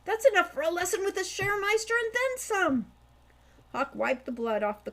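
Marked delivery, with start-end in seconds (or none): 0.0-2.9 high, louder gleeful voice; delighted. Acted. Hawk gloating over the loot, gleeful. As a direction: bubble over with glee.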